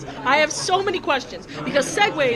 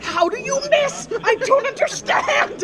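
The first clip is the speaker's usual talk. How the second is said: high, laughing, incredulous rant; giggling, high-pitched disbelief; 7 semitones higher than usual. Real, not acted.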